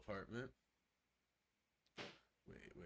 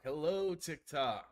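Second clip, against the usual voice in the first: loud, bright hello to the stream chat; excited greeting; 6 semitones higher, 6 dB louder, slower than usual. Real, not acted. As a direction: call out happily to viewers. Greeting stream going live.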